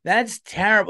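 put-on high, slow, scandalized valley girl; mock-gasp at rudeness, drawn out and high; offended woman. Woman president acting offended, high and scandalized. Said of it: "you can't have a woman like".

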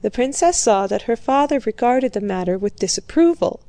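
ordinary talk, nothing marked

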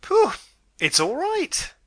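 high, loud burst of relief; delighted relief. Acted. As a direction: blow out the breath, then beam with delight.

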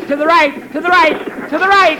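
shouting directions. Acted. Passenger shouting parking directions at Jack, building shout.

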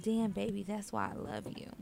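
soft breathy whisper of a lover; whispered quote. Retold or imitated. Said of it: "he says it again".

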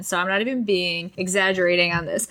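ordinary delivery

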